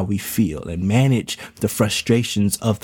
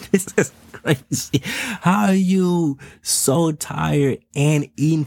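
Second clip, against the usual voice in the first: half-laughing exasperation, voice lifted higher; amused disbelief; 6 semitones higher, slower than usual. Retold or imitated. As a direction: retell it like you still can't believe the baby. Dad retells exasperated talk to baby.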